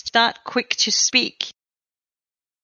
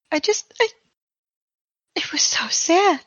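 tearful whisper; 5 semitones higher than usual. Acted. Grieving NPC whispers.

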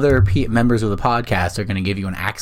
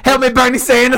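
high, loud mock panic; panicked meme impression; 13 semitones higher, 6 dB louder than usual. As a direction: yelp it like a frantic movie parody. Acts out a meme of the scene.

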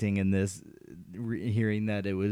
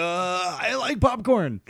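high goofy voice; 8 semitones higher, 4 dB louder, slower than usual. Retold or imitated. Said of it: "He's like".

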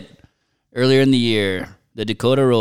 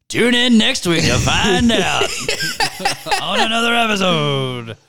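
mock announcer sign-off; 6 semitones higher than usual. Co-host teasing next week's episode, mock announcer sign-off. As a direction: ham up a cliffhanger outro.

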